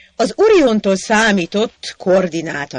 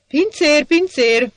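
high, loud call across the café; 9 semitones higher, 4 dB louder than usual. Acted. Hacsek calling the waiter across the café, high and loud.

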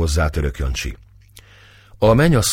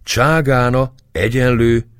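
excited exclaiming; 5 semitones higher than usual. A narrator's reading. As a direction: show off new Turkish words with delight. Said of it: "kiáltott Éva tapsolva". (lelkes, izgatott felsorolás)

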